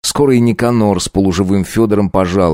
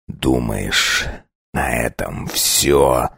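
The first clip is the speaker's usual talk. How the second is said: low, slow and cold; icy voice; 8 semitones lower, 5 dB quieter, much slower than usual. Acted. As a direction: menace each word quietly. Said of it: "произнёс ледяным голосом".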